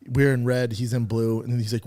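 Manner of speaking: ordinary talk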